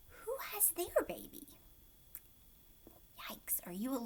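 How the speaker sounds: hushed worried whisper. Real, not acted. Teacher asking kids about the missing baby, hushed whisper. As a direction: whisper it like a worry shared.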